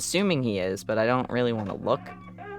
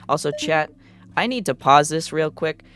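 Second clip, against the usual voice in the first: usual speaking voice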